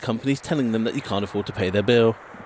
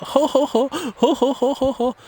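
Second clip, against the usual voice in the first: high, louder hammed-up posh ho ho ho; imitating a fake posh laugh; exaggerated posh laugh; 4 dB louder than usual. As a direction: laugh like a caught-out posh mayor. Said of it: "sounds like a posh woman and a posh man laughing".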